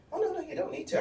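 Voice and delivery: high-pitched mock protest; high, imitated placating objection; flustered backpedalling. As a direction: mimic someone hastily waving off an explanation. Mimics man backing away from confrontation.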